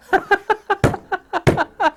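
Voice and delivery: high, stuttering giggle; wheezing laugh. Real, not acted. Laughing at his own hippie hugs joke, high wheezy giggle. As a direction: crack up at your own joke.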